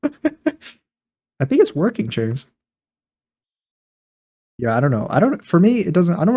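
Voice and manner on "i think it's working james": giggling through the words; laughing. Real, not acted. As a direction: laugh it out, amused. Laughing while praising James.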